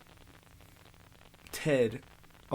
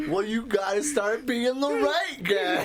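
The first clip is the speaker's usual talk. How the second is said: laughing quote; 9 semitones higher, 4 dB louder, slower than usual. Retold or imitated. Quoting movie line while laughing.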